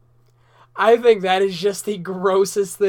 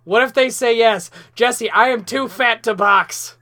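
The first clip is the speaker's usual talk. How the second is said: mock panic; 4 semitones higher, 6 dB louder, slower than usual. Mock panic about boxing challenge.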